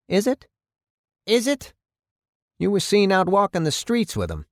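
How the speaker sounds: high, rising challenge, then a pointed accusation; challenging mayor. Acted. Mayor confronting the chief over his friend, challenging.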